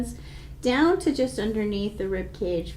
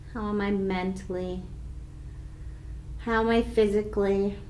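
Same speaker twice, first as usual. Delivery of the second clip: soft, unhurried questions with pauses; calm meditation voice; guided meditation questions; 5 dB quieter than usual. Acted. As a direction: ask each one gently, then wait.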